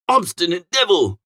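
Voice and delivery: plain reading voice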